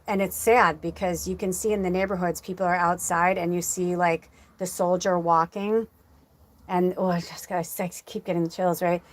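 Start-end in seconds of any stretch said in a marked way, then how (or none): none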